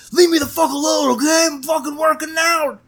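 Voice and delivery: high, yelled mock outburst at the boyfriend; hammed-up furious brush-off; mock angry snap. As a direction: bite his head off in a shrill put-on yell. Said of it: "And you're like".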